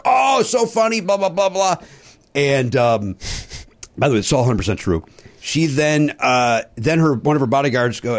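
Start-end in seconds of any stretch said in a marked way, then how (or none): 0.0-1.8 breezy Palin dismissal. Retold or imitated. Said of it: "She says to Todd".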